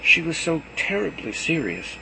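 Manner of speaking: ordinary delivery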